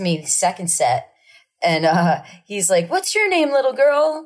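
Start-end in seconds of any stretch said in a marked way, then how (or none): 2.9-4.2 much higher, sweet showman voice; cooing entertainer. Retold or imitated. Mimicking singer cooing at a child, high. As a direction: coo at the little girl.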